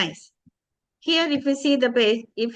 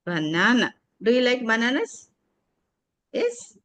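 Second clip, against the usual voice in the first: usual speaking voice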